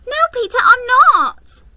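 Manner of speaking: put-on high, loud protesting child; a little girl protesting she was gone for hours; high insistent little girl. A narrator's reading. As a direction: insist earnestly. Lucy protests.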